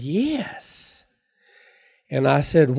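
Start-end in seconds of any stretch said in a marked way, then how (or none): 0.0-1.0 high, rising, drawn-out yes; lightly acted sweet lady, voice swooping up; sweet little woman. Sweet woman answering an old customer's question, high and lilting. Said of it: "She was a sweet little woman".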